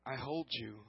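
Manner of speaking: soft, tender whisper-like reassurance; God's quiet promise; hushed voice of God. Retold or imitated. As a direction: say it gently, like a promise held close.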